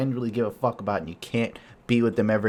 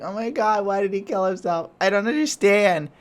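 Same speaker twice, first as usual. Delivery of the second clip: higher, whiny mock bafflement; mimicking girlfriend; 11 semitones higher, 4 dB louder than usual. Imitating his girlfriend Jen's clueless dismay, higher and whiny. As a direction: play her as clueless and upset. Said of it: "She's like".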